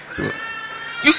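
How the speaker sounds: usual speaking voice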